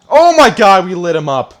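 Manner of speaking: loud, high "oh my god" of delight; excited outburst. Real, not acted. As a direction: yell it out over a big hit. Big hit in the game.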